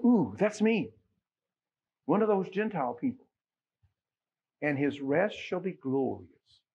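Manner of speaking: ordinary talk, nothing marked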